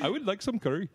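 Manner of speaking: put-on botched country voice sliding into an Indian accent; amused, clumsy Indian accent. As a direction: attempt an Indian accent, half laughing. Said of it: "oh, that's an Indian accent".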